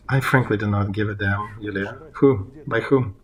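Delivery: ordinary talk, nothing marked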